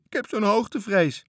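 terrified of the height; scared child. Acted. Toontje held aloft by the pirate, scared plea. Boy dangling, afraid of heights.

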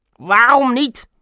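high, loud challenge; sharp demand. A narrator's reading. Suspicious neighbour demands an answer. (luide, hoge, felle eis)

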